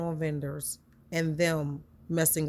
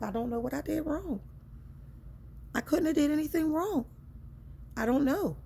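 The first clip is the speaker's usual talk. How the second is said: baffled distress; 10 semitones higher than usual. Retold or imitated. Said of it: "And I'm baffled".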